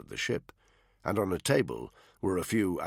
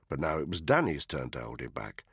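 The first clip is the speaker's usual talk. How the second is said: ordinary delivery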